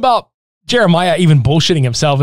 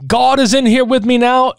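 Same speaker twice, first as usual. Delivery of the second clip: higher preacher voice; 9 semitones higher than usual. Retold or imitated. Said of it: "he's like".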